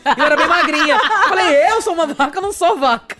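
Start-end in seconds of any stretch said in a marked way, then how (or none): 1.7-3.2 laughing protest. Retold or imitated. Retold confused indignation, laughing.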